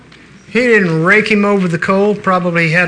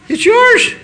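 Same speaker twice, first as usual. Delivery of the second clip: "It's yours" flung out much higher and louder; fervent preacher cry; emphatic proclamation; 3 dB louder than usual. Acted. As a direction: shout it out like good news breaking over the room. Preacher calls out emphatically to congregation.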